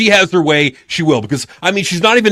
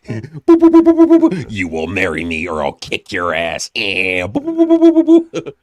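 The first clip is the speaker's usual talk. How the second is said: weird voice in head; 7 semitones lower than usual. Acted. Co-host mimics the voice in her head, spooky mock menace. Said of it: "A weird voice in my head went".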